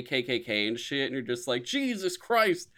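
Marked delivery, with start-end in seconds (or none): 1.6-2.7 high, slow, stunned Jesus Christ; slow, high, dismayed. Retold or imitated. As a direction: drag it out, stunned. Stunned reaction to footage.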